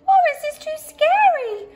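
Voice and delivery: all the mice squeaking at once. A narrator's reading. Mice squeaking together about the scary bear, very high. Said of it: "they all squeaked together".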